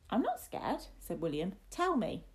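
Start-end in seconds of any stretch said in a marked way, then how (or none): 0.1-0.9 put-on high-pitched boyish protest; defiant boy. Boy denies fear to his teasing companion, high and defiant. 1.7-2.2 high, firm little demand; insistent boy. Acted. Boy demands the story from his companion, high and insistent.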